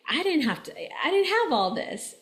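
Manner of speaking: put-on a grown man sulking about his childhood; mock resentment.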